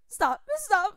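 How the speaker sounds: loud, rising cries of stop; desperate shouts. Acted. Bound man shouting at captor to stop. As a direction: yell for her to stop.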